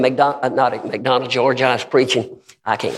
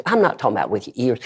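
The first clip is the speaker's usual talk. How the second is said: normal talking voice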